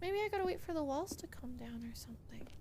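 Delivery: soft whispered thinking. Real, not acted. Player whispering a thought while stuck. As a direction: whisper it to yourself.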